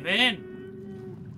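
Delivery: very high, soft little call; high sing-song call to the creature; coaxing squeak. Acted. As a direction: coax it like calling a pet.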